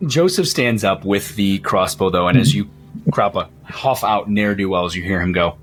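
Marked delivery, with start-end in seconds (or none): none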